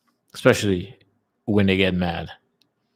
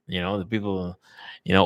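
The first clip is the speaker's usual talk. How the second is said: usual speaking voice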